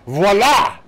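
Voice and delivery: loud, high triumphant cry; delighted, ringing eureka; satisfied exclamation. A narrator's reading. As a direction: burst out in triumph. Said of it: "uttering an ejaculation of satisfaction".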